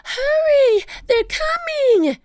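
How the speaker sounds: high, loud, drawn-out yell; alarmed high-pitched holler; urgent warning shout. A narrator's reading. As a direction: yell high across a distance. Annie yells warning from treehouse.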